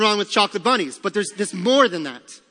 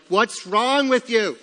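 exasperated parent; 4 semitones higher, 4 dB louder, slower than usual. Retold or imitated. Parent to crying sick child.

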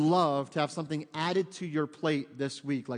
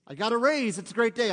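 higher, louder cheer, hammed up; mock elation; 5 semitones higher, 5 dB louder than usual. Worldly person cheering a raise, mock elated. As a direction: play the worldly high. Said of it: "This is the world".

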